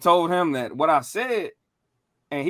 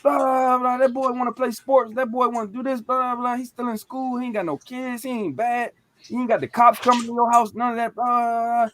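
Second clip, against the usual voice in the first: high-pitched indignant rant; imitated cussing-out; 5 semitones higher than usual. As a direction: act out an uncle telling someone off for a kid. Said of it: "He cussed both of 'em out".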